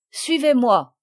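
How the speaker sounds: ordre lancé plus fort; commande-les sèchement et fort; voix de commandement. Acted. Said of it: "leur dit-il de sa voix de commandement". (le commandant ordonne aux sauvages, d'une voix forte)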